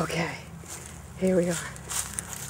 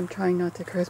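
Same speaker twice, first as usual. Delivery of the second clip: usual speaking voice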